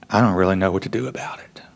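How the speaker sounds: hushed, quick helpless whisper; father whispers, overwhelmed by grief; whispered helplessness. Retold or imitated. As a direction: whisper it, lost and unsure. Grieving father's confession.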